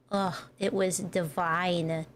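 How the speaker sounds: ordinary talk, nothing marked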